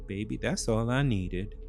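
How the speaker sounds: ordinary talk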